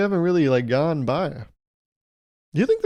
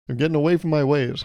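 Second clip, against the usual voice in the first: ordinary talk, nothing marked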